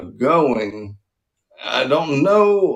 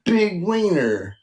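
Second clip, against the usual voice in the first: higher-pitched, mock gloating laugh-line; mock bragging; 6 semitones higher than usual. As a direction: crow it like a silly braggart. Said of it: "me being like".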